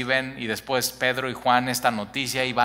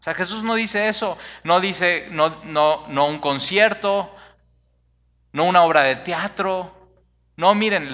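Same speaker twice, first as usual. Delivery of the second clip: higher and louder, hammering each "no"; fiery preaching; 6 semitones higher, 6 dB louder than usual. Real, not acted. Preacher rebuts the idea of a picture, preaching loud and fiery. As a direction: preach it hard, stacking the denials.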